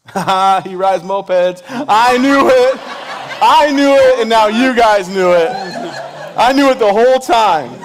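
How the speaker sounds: high, loud, slow triumphant crowing; laughing loud mock triumph; gleeful teasing gloat. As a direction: rub it in with laughing glee. Teasing volunteer about mopeds.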